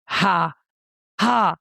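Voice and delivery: spoken, mocking movie-character "ha, ha"; imitated villain laugh. Ex standing over her on the ground, imitated mocking laugh. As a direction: imitate a gloating laugh in separate beats. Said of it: "like a movie character laughing over me".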